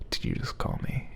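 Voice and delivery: hushed, unvoiced, eerily calm; eerily calm whisper. A narrator's reading. Narrator whispers Danny's eerie question, calm and hushed. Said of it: "in an eerily calm voice".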